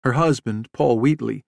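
usual speaking voice

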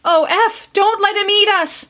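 put-on high pleading child's cry; little girls begging brother, crying out high; frightened cry. A narrator's reading. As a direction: beg your brother like a terrified little girl. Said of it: "cried both little girls".

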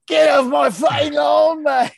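high, loud Scottish groundskeeper shout; bad Scottish accent. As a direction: holler it in a botched Scottish accent. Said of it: "or however that Scottish accent goes".

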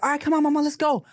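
put-on high, sing-song talk to a toddler; coaxing parent voice. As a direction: coax a little one along. Dad voices himself coaxing his toddler.

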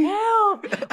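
high, wailing mock cry; mock crying help. Mock crying for help on the porch. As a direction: whimper it high like a hammed-up plea.

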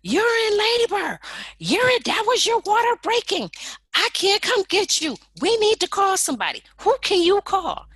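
high, loud panicked scream; screaming friend. Retold or imitated. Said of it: "She screamed".

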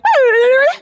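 high loud shrill bird squawk. Retold or imitated. Mock bird fight, high loud squawk. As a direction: squeal like an angry bird. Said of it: "She was like".

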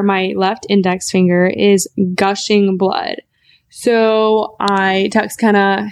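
usual speaking voice

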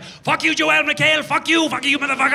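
high-pitched angry heckling; hostile crowd. Retold or imitated. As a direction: curse him as an outraged mob. Hostile crowd cursing the host.

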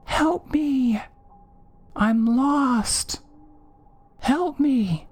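put-on very high, eerie child voice; high, drawn-out plaintive cry; mimicked little girl's cry. A narrator's reading. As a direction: cry out like a creature imitating a lost girl. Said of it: "mimicking cry of a little girl".

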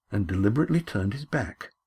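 ordinary delivery, nothing marked

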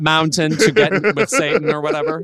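laughing riff. Real, not acted. Riffing joke, laughing.

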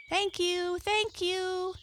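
high excited thanks; excited cry. A narrator's reading. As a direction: squeal your thanks high. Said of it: "cried the Merry Little Breezes".

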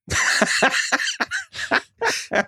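a long breathy squeal of laughter; falling apart laughing; high wheezing laugh. Real, not acted. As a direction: wheeze up high until out of air. Laughing at the dad's "Neat.

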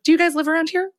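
high-pitched nosy questioning; put-on high Karen voice; suspicious Karen.

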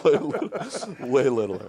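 high, loud laughing repeat; laughing hard. Real, not acted. Host cracking up over a silly word, laughing loudly.

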